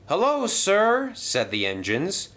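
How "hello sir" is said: high, drawn-out hello; slow, loud, high-pitched greeting; engines' greeting. Acted. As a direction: greet the boss like obedient pupils. Engines greet new controller in chorus.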